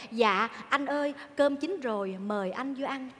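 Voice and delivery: soft, slow mock-submissive call; hushed, deferential parody of servility; meek dutiful wife. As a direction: fade away in sweet obedience. Mimics dutiful wife serving husband.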